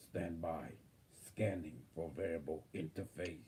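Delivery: calm robotic system readout. Acted. Channels AI from future.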